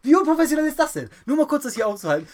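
loud, high scolding teacher voice; mock strict teacher. Mock teacher scolding Moritz in class, loud and high. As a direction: play the teacher telling off a pupil.

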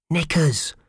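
plain reading voice

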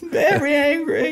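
high squeal through laughter; mock hysterical child. Joking as the boy reading dad's tone, high mock wail. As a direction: finish the kid voice while laughing. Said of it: "I'm just, I'm hysterical".

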